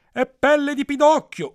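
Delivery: high, loud cry of victory; hammed-up triumphant yell; triumphant shout. A narrator's reading. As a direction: shout the winning answer in triumph. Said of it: "urlò trionfante".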